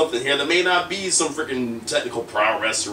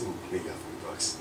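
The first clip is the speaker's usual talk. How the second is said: much softer, almost breathed aside; hushed dismay; 6 semitones lower, 14 dB quieter than usual. Retold or imitated. Brothers seeing the forty-dollar breakfast bill, whispering in dismay. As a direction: drop to a near whisper over the price.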